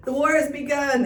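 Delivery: emphatic, mock-dramatic declaration; lightly hammed-up proclamation; exclamatory announcement. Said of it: "Exclamation points".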